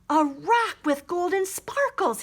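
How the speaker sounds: amazed by a plain rock; marveling. A narrator's reading. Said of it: "he marveled".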